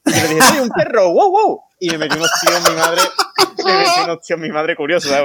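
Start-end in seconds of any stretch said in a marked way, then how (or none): 0.4-1.6 high yappy barking, a kid pretending to be a dog; boy tells his mother he is a dog, yapping high like a puppy; playful dog bark. Answers mother that he's a dog.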